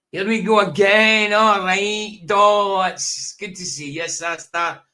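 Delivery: hammed-up Glaswegian, calling out cheerily; booming Glaswegian hello to the viewers; Glaswegian accent. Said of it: "Talking like a Glaswegian here".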